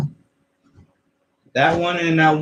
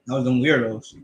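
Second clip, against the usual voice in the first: normal talking voice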